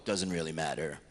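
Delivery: ordinary delivery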